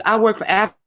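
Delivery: normal talking voice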